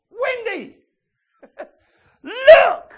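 high loud excited call; childlike excitement. Acted. Preacher calls his wife Wendy, high excited shout.

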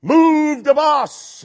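loud, high, drawn-out call; show host's shout. Acted. Someone orders the bus away, long loud shout. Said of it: "suddenly somebody says".

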